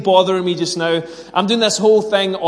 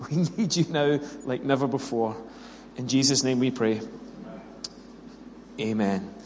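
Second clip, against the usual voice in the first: hushed, lower prayer, sinking softer towards the amen; quiet, heartfelt public prayer; earnest prayer; 3 semitones lower, 4 dB quieter than usual. Acted. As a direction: plead gently with God. Leads congregation in prayer.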